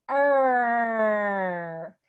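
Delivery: long sustained "R", sliding down; drawn-out R sound. Shown as a demonstration. Coach showing how to make R, drawn-out "R". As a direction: hold a voiced "R" with the tongue back and up. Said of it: "It's going to sound like this".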